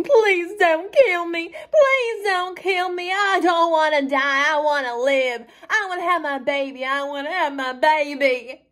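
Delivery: high, loud tearful wail; crying and begging. Acted. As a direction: plead for your life, drawing the words out. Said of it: "looked at me crying, begging".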